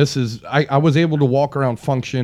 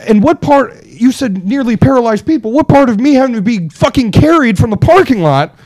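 higher, louder indignant protest; retold furious argument; 6 semitones higher, 5 dB louder than usual. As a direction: demand an answer from the dismissive doctor. Retold angry argument with doctor.

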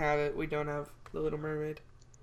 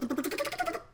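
far higher, rising whoa; squeaky whoa. Acted. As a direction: squeak it up high. Whoa climbing high.

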